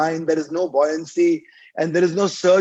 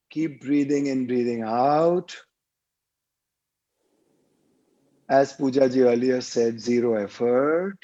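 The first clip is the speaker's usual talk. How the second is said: lower-pitched meditation voice with long pauses; guided meditation; 4 semitones lower than usual. Real, not acted. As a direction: guide listeners through each breath, drawing out the cues. Leads a guided breathing meditation.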